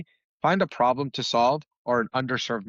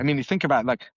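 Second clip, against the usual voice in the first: ordinary talk, nothing marked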